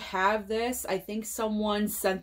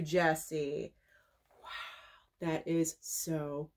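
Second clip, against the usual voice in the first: whispered thanks, much softer; awed whisper; 4 semitones lower, 7 dB quieter, much slower than usual. Real, not acted. Thanking Jessie for the DVD, an awed whisper. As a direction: breathe it out in awe.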